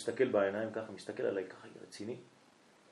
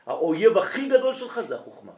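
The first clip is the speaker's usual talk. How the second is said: emphatic warning; 8 semitones higher, 8 dB louder than usual. Retold or imitated. Telling boy his wisdom is enemy.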